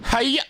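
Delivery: high, sudden "hiya" attack cry; martial-arts yell. Acted. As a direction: let out a sharp high chop yell. Detective cuts protest with attack.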